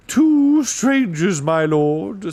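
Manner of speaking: high, slow, hooty announcement; hooting owl. A narrator's reading. Owl reporting to the dwarf lord, hooting. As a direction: stretch TWO into an owl's hoot. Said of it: "said the owl".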